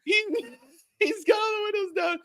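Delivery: high-pitched, cracking up mid-story; real laughing. Real, not acted. Storyteller describing the open car windows, laughing. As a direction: tell it through bursts of laughter.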